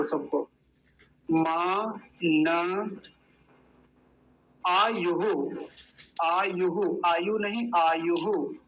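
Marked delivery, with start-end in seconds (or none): none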